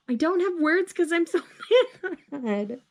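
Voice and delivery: high-pitched exasperation broken by a laugh; mad. Real, not acted. Reader lost for words over the ending, laughing exasperation. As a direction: sputter it, half laughing.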